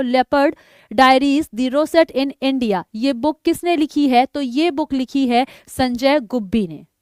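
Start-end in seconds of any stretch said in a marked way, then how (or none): 0.0-2.8 slow read-out. Shown as a demonstration. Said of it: "तो English में देख लो".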